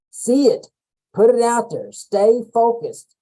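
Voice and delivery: rally the audience; rallying call. Real, not acted. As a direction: coach rallying listeners, loud and emphatic. Rallying closing lines.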